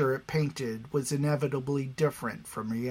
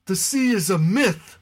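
higher, louder dismissive voice; skeptical crowd member; 7 semitones higher, 7 dB louder than usual. Acted. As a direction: dismiss it out of hand.

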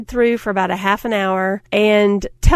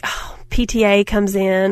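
ordinary talk

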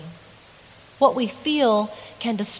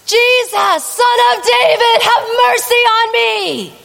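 much higher, much louder desperate cry; shouting even louder; 13 semitones higher, 9 dB louder than usual. Retold or imitated. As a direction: scream it over the crowd, begging. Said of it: "he starts shouting even louder".

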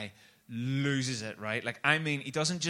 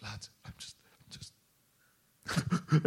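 mock crying; 3 semitones lower, much slower than usual. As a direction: start faint, then dissolve into pretend sobs. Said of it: "he just starts crying".

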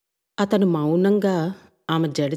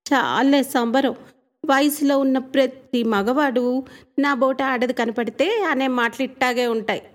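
put-on village girl; 5 semitones higher than usual. Rangi teasing the young man, playfully scoffing.

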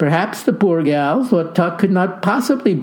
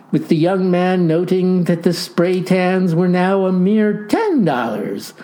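ordinary delivery, nothing marked

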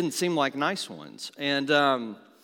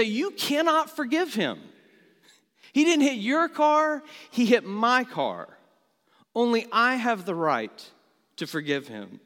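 higher and louder, an outraged driver protesting; indignant retort; 5 semitones higher, 3 dB louder than usual. Pretend. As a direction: insist it's your car, voice rising in mock outrage. Imagined angry lady retorts.

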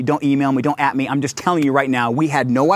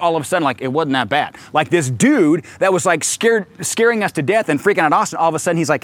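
ordinary talk, nothing marked

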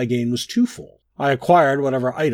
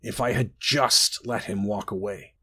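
ordinary delivery, nothing marked

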